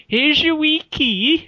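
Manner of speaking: high, slow Scottish imitation; hammed-up Scottish host handing over a key; mock Scottish lilt, high and drawn out; Scottish landlady. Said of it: "but she said".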